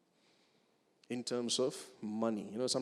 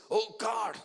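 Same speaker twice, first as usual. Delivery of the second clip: 1 panicked cry; 11 semitones higher, 4 dB louder than usual. Acted. Terrified atheist cries out.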